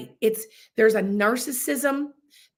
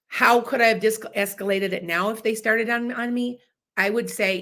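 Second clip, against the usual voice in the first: ordinary delivery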